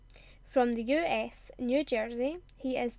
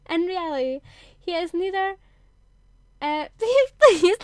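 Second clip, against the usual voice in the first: giggling, higher and much louder; cracking up; 5 semitones higher, 10 dB louder, faster than usual. Real, not acted. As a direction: lose it mid-sentence.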